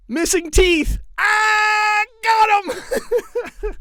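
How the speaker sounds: gloating punchline shout; triumphant punchline. Acted. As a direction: crow it like you nailed the joke. Punchline of his own joke.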